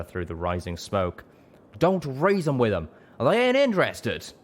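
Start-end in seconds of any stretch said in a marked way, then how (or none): 1.8-4.3 much higher, louder, twangy; angry yelling. A narrator's reading. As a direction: bellow across the fight. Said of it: "Calamity shouted".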